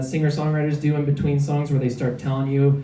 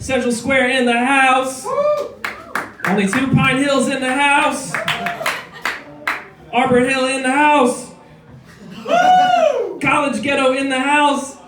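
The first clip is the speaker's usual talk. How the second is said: hype-man call-out; 8 semitones higher than usual. Acted. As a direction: call each neighborhood out like an MC. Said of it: "hyping up neighborhoods to crowd".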